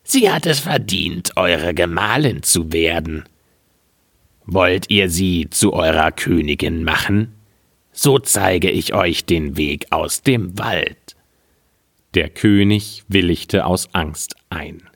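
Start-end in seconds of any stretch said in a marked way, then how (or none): 0.0-11.2 a hint of a scheming old woman; old witch woman. Old woman offering the king the way out, lightly voiced crone.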